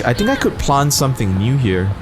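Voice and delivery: ordinary talk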